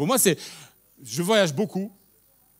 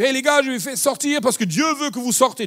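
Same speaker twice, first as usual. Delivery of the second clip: higher, louder rallying call; upbeat call to the crowd; eager announcement; 6 semitones higher, 5 dB louder than usual. Acted. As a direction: play Moses bursting in with good news. Moses arrives to free slaves.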